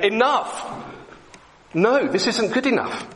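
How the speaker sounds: high, urgent cry of enough; prophet shouting. Acted. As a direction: call the people to stop, pitched high. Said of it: "He's shouting to the people".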